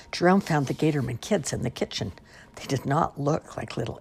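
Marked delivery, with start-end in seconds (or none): none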